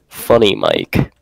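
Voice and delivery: low, slow trailer-style announcement; deep announcer voice. Pretend. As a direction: announce the name like a movie trailer. Presenting the name to viewers.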